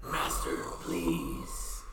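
low whispered pleading axe; evil axe whisper. Acted.

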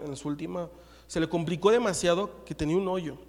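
normal talking voice

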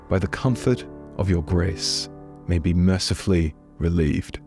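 ordinary delivery

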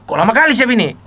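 mock harsh question, a touch loud; a bit harsh. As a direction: imitate mother pressing the mechanic. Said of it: "Tapi emang agak keras".

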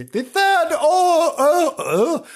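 "the third Earl" drawn out high and slow, grand; grand, stumbling title. Real, not acted. Host tripping over a noble title, going high and slow. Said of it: "Why can't I say that?".

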